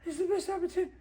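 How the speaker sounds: high-pitched disbelieving protest; incredulous pleading. Acted. To a partner walking away from the deal, incredulous pleading. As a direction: plead with him, voice jumping way up.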